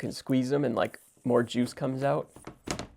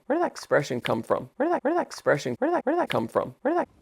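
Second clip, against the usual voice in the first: pitched way up, squeaky; high squeaky voice; 12 semitones higher, faster than usual. Shown as a demonstration. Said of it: "repeated differently".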